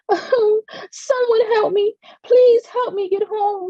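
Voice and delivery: high, sobbing, slow plea for help; a tired lost child breaking down; crying loudly. A narrator's reading. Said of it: "she cried loudly".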